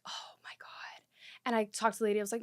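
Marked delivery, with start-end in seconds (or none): 0.1-1.0 very soft, voiceless whisper of shock; horrified whisper. Retold or imitated. Test-taker just accused of cheating, retold horrified whisper. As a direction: breathe it out, barely audible.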